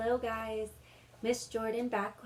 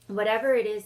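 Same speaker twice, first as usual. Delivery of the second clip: louder, steady warning; firm Peter; 10 dB louder, faster than usual. Acted. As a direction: take charge like the eldest brother. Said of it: "said Peter".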